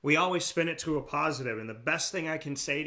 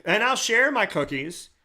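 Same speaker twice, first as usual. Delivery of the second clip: higher, louder playful rant; excited; 9 semitones higher, 4 dB louder than usual. Real, not acted. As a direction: bubble over with amused conviction about the cookies. Playful cookie analogy rant.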